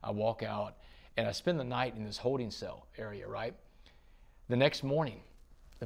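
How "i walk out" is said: normal talking voice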